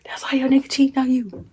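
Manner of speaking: high, quick and soft mock taunt; held-back taunt. Teller mimics the girl held back by her sister, mocking. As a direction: imitate a rival tossing out a jibe, high and soft. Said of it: "her sister was holding her back like this".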